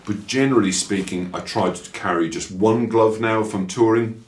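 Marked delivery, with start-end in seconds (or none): none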